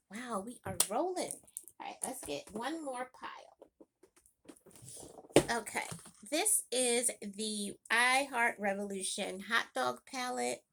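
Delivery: normal talking voice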